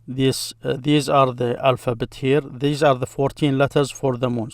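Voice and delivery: ordinary talk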